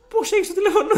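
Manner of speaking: very high, loud retold astonishment; mock shocked question. Student wonders how headmaster got number, shrieked mock shock. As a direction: squeal the question in exaggerated disbelief.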